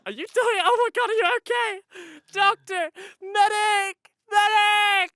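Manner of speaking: high, loud, crying shout for a medic; man down shout. Pretend. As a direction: yell for help in a hammy panic. Calls for medic, pretend emergency.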